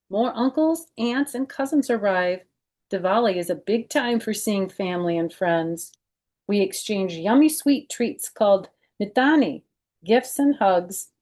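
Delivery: lively read-aloud storytelling voice; children's book narration; story narration. Acted. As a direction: read it to kids, bright and clear. Reads picture book aloud.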